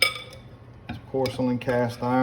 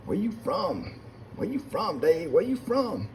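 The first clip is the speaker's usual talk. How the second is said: high, softer put-on voice; mimicked nosy questioner; 7 semitones higher, 4 dB quieter than usual. Locals asking the newcomer about his accent, high mimicked voice. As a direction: imitate someone prying, pitched way up.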